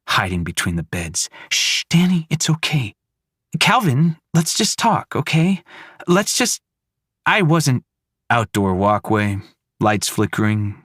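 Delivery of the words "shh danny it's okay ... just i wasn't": high, gentle pleading to calm him; soothing mother. Acted. Mother calming her son and her husband, gently pleading. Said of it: "mother soothing child and husband".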